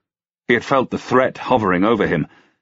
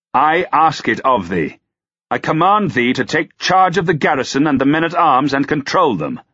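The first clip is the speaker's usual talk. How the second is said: higher-pitched, insistent order; imperious lord; commanding; 8 semitones higher, 3 dB louder than usual. A narrator's reading. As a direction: lay down the command, overriding refusal. Lord commanding a reluctant knight.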